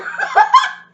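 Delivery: loud shrieking laugh; real helpless laughter; high burst of laughter. Real, not acted. Cracking up at numbers.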